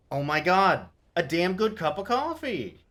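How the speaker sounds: loud delighted outburst; thrilled loud shout of joy; excited exclaim. Real, not acted. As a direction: gush at the reference. Thrilled by game reference.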